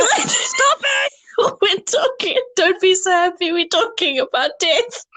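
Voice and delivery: very high, squealing through laughter; helplessly giggly scolding; laughing protest. Real, not acted. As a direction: beg them to stop while cracking up. Laughing while scolding about death.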